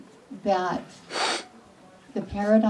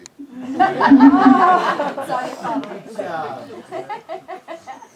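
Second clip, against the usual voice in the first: loud laugh; 6 semitones higher, 10 dB louder than usual. Real, not acted. Laughing at a tipsy joke.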